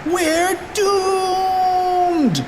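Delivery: very high, drawn-out wail of doom; comic high-pitched despair; panicked shout. Acted. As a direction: wail it like a cartoon kid falling off a roof. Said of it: "shouted Cheeseball".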